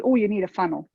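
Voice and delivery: ordinary talk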